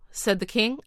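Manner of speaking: ordinary delivery, nothing marked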